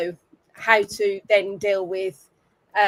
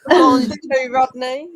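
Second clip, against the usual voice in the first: put-on Del Boy French; 3 semitones higher, 7 dB louder, slower than usual. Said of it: "Del Boy French".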